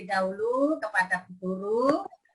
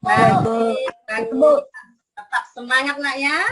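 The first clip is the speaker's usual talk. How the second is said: kindergarten teacher voice; 5 semitones higher, 5 dB louder than usual. Acted. Teacher praising pupils.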